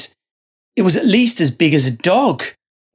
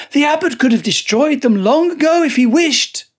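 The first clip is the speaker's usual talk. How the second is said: heated retort; 11 semitones higher than usual. Acted. Joseph argues hotly.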